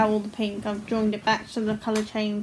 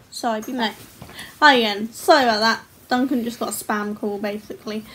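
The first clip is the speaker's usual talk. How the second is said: ordinary talk, nothing marked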